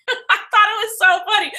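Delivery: high voice through laughter; laughing. Real, not acted. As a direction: laugh while saying it. Laughing at the tweet.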